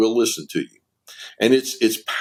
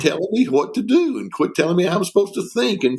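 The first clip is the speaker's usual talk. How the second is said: imitating an upset wife, pitched high; high-voiced, exasperated plea, lightly acted; retold breakdown; 7 semitones higher than usual. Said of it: "she just broke down even more and said".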